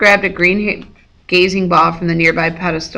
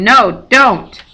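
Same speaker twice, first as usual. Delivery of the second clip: sharp cry, up and louder; screamed warning; 4 semitones higher, 5 dB louder than usual. A narrator's reading. Annabeth stopping Percy from looking up, crying out. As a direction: cry out to stop him in time. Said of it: "Annabeth screamed".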